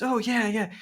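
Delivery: high, eager waiter reply; lightly voiced enthusiastic waiter; imitating the waiter. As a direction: beam like a keen fan. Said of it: "And he goes".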